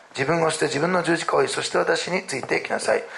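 measured Bible reading voice; scripture reading. Acted. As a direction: read the passage aloud, deliberately. Reads scripture aloud. (聖書朗読の読み方)

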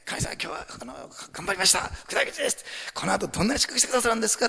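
hushed start lifting into a high, quick plea; expectant giver. Acted. Giver presenting a banknote to God, eagerly expectant. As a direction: offer it up with eager anticipation. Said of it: "期待して献金する人と".

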